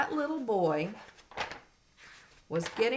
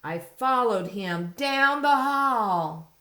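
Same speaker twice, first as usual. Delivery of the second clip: slow, louder storybook line; reading to a toddler; 5 semitones higher, 8 dB louder, slower than usual. Acted. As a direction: read it slowly with suspense. Reading picture book aloud.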